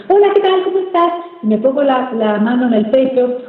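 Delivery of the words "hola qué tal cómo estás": high, put-on greeting; artificial voice. Shown as a demonstration. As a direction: speak up in a disconnected high pitch. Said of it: "me pongo a hablar con una voz totalmente". (voz aguda y artificial)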